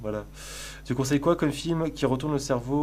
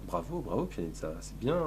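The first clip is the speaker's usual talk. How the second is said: doux; 5 semitones lower, 9 dB quieter than usual. Real, not acted. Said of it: "applaudissez-moi".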